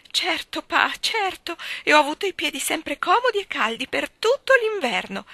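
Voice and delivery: high, loud tearful pleading; between sobs. A narrator's reading. Mrs Taylor sobbing to calm her husband, high and loud. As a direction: cry out the denial. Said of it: "tra le lacrime".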